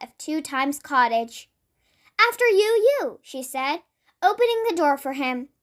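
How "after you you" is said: high polite offer with echoed word; chirpy courtesy, echo the end; twin's echo talk. Acted. Miss Twin holds the door for Mister Nosy, high and bright. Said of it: "why she was talking like that".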